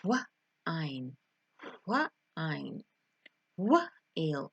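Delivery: "wine" broken in two, "wuh", pause, then the rest; separated, slow. Shown as a demonstration. Teacher saying it slowly for learners, split into two parts. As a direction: puff out the w, stop, then finish "wine". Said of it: "separate it and say it slowly".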